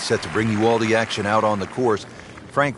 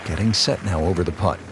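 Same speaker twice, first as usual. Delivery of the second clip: golf commentator; 2 semitones lower than usual. Acted. Game commentary play-by-play.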